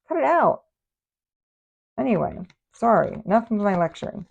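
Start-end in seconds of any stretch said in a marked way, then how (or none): 0.1-0.6 mock scolding. Scolding the viewer playfully.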